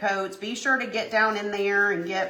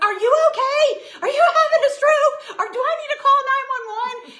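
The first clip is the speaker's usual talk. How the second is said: high, loud, laughing mock panic; friend's frantic texts, voiced high and laughing; mock panicked friend; 8 dB louder than usual. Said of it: "She was like".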